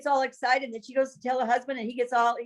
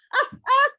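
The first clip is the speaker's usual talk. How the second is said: tearful laugh; 5 dB louder than usual. Real, not acted. Laughing with tears after the answer.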